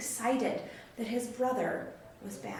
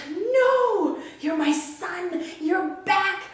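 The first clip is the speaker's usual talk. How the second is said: much higher and louder, slowing on my son; joyful father; 5 semitones higher, 5 dB louder, much slower than usual. Acted. The father refusing his son's offer to be a servant, joyful. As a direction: insist warmly, full of joy.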